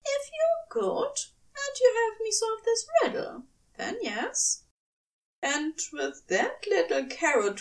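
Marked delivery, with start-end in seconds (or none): 0.1-4.6 much higher, quick angel voice; a playful angel bargaining; high, brisk, teasing angel. A narrator's reading. Aziraphale teasing Crowley.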